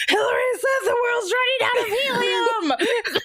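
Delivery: very high, drawn-out mock wail; hammed-up child sounding the alarm; imitated panicked child. Son's alarmed report to dad, imitated.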